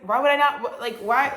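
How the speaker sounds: loud, high incredulous why; mock outrage. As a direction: act baffled and offended. Gemini's mock reaction.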